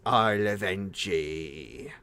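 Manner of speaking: slow, drawn-out mock death cry; dying dwarf impression. Host mimicking a game character's death line, slow mock dying cry. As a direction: draw it out slowly like a hammed-up dying character. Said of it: "he goes".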